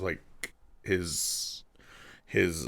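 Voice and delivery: normal talking voice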